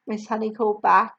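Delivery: plain reading voice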